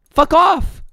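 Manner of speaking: high-pitched irritated brush-off; exasperated dismissal. Real, not acted. Dismissing angry chatters.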